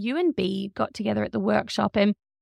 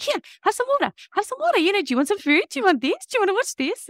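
mock distracting parent; 10 semitones higher, faster than usual. Role play, distracting the upset friend.

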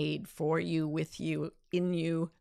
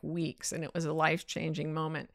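normal talking voice

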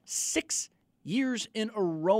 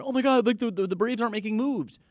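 higher, louder imitation of fretting fans; mock panicking fans; 6 semitones higher, 5 dB louder than usual. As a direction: mimic fans fretting over their team. Acting out worried Braves fans.

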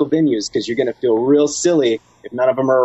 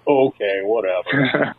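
bummed-out mumble; 2 semitones lower, much slower than usual. Pretend. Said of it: "the real bummer one".

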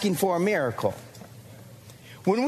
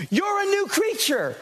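much higher, ringing out "new"; high excited proclamation; 9 semitones higher than usual. Real, not acted. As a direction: lift it up like a revelation. Preacher's rising declaration.

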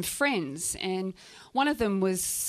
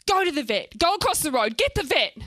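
raised, rising-pitched commands; urgent orders; 6 semitones higher, 5 dB louder than usual. Acted. Shane sending the owner for the vet, urgent orders. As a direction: fire off instructions in an emergency.

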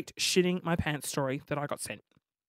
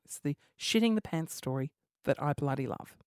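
usual speaking voice

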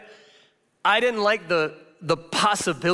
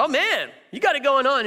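impressed dad; 9 semitones higher than usual. Retold or imitated. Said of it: "the other dad's like".

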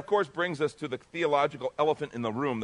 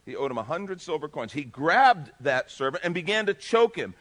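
usual speaking voice

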